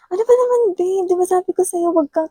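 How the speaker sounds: shrill mock scolding of a partner who didn't listen; exasperated scolding. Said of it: "galit na galit siya sa'yo".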